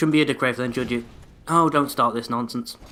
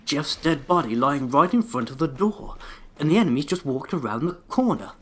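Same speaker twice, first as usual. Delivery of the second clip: a hint of a pompous wraith, rising higher as it goes; mock enemy inner voice; 3 semitones higher than usual. Gamer mocking a wraith's thoughts, mild pompous voice. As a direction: narrate the wraith's thoughts as a self-important fool. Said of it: "You not think".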